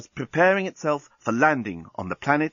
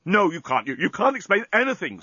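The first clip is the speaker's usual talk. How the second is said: higher stammering angry denial; mild mock scream; 7 semitones higher than usual. A narrator's reading. Dan to Lucy, angrily refusing. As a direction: cut her off angrily. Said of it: "screamed Dan".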